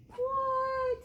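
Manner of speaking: high, drawn-out what; astonished. Acted. Storyteller hears George quack for the doctor, high astonishment. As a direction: squeal it in disbelief. Reader reacts to more quacking.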